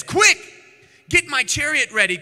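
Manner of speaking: sudden loud, high order; panicked king calls for his chariot; urgent command. Acted. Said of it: "King Joram commanded".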